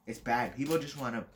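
normal talking voice

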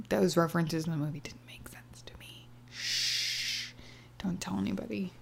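unvoiced secretive whisper. Real, not acted. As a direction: hush it like a confession. Confessing a secret, hushed.

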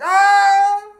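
high loud scream fading away; distant scream. Retold or imitated. Friend screaming down the street, doppler scream.

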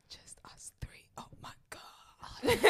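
breathy, drawn-out stage whisper; excited whisper. Acted. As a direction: whisper it slowly in mock awe. Mock awed aside in intro.

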